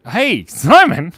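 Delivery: slow, loud call across the room; drawn-out hail to a viewer. Real, not acted. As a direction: call his name out. Calling out to a chatter.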